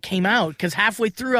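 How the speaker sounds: normal talking voice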